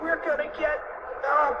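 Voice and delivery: high panic, groping for words; panicked cry. A narrator's reading. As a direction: cry it high, hesitating midway. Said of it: "cried Fluffy".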